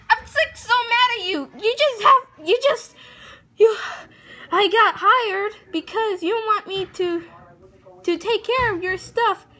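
high voice for the other figure; high puppet voice. Acted. High-pitched voice of the second figure.

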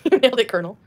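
mock praise with a laugh; laughing sarcasm. Real, not acted. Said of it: "Yes, you're right".

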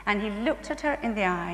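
ordinary delivery

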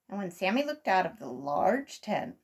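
usual speaking voice

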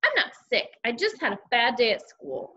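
ordinary delivery

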